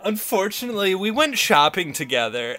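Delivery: put-on upbeat California rich girl, high and bouncy; valley girl voice. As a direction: gush like a Valley Girl cheerleader. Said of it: "Can you do one?".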